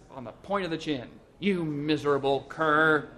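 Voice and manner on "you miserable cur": loud, slow heroic denunciation; ringing scorn, drawn out; contemptuous insult. A narrator's reading. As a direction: fling the insult with force. Hero insults attorney after punching him.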